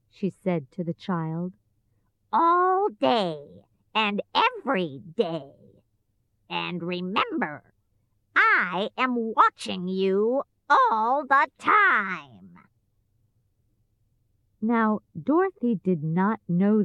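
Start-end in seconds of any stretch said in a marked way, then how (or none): 2.3-12.6 slow, drawn-out witch threat; wicked witch. A narrator's reading. Witch warns child she is watching, menacing. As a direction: stretch each word like a watchful witch.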